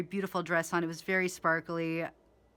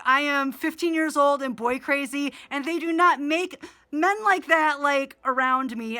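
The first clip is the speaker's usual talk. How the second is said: put-on higher and louder, breathless fan-girl gush; gushing teen excitement; 6 semitones higher, 7 dB louder than usual. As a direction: relive being a boy-crazy fifteen-year-old. Teen self gushing over player.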